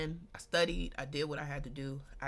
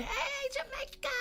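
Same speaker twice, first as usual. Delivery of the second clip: calling out a greeting. Real, not acted. Storyteller breaks off to greet Jamaica, calling out high. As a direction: sing out a much higher greeting.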